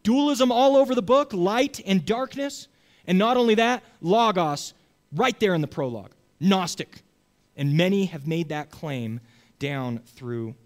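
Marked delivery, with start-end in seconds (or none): 0.0-7.0 raised, insistent voice of accusing scholars; mock scholars. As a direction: play the scholars sure they caught John out. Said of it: "caused scholars to say".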